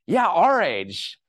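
loud, drawn-out sarcastic agreement; mock agreement. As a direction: stretch it high and loud, joking. Mock agreement about shared age, laughs.

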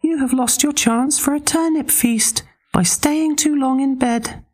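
very high teasing pig; cheeky little pig gloating. Acted.

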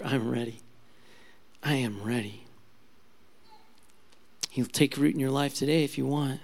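soft, hushed preacher's invitation; quiet altar call. Acted. Preacher gently inviting listeners to surrender, soft and hushed. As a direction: draw the listener in gently, almost confiding.

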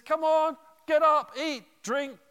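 loud, bright command to get up; rousing angel. Retold or imitated. Angel rousing Elijah with food.

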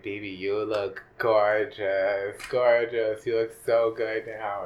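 loud, slow, gushing praise; gushing mentor. Retold or imitated. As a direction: lay it on thick like a proud mother. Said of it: "she was like".

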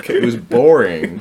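ordinary delivery

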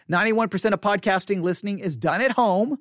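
normal talking voice

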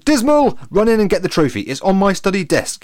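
high, loud commanding shout; bellowed order. A narrator's reading. Latham sends George for the trophy, bellowing. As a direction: call out orders across a field.

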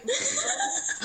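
high squealing laugh; high burst of laughter. Real, not acted. Laughing at breathing in face.

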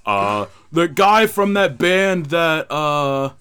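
slow, loud, halting mock voice; mock dazed guy. Mock dazed fan trying to recall a band name. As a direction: drag it out like a confused fan. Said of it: "he's gonna be like".

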